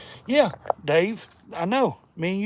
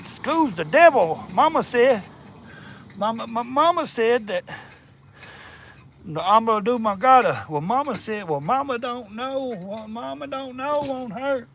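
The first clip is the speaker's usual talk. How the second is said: movie character voice; 6 semitones higher, 4 dB louder than usual. Retold or imitated. Quoting mama in a mock Southern film voice. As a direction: play a simple Southern man quoting his mama. Parodying a famous film's mama quotes.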